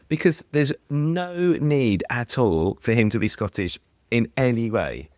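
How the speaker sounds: ordinary talk, nothing marked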